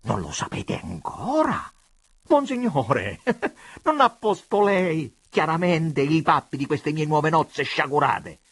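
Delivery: forceful, incensed questioning; wrathful rebuke; irate. A narrator's reading. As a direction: demand it with rising anger. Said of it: "col volto scolorito dall'ira".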